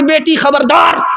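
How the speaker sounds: high, sharp call with a rising end; stern warning. Acted. Mother warning her daughter to beware, high and sharp.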